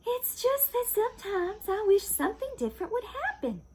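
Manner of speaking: high, longing wife's voice; Missus Watson voice. Acted. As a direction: pitch her up high and wistful.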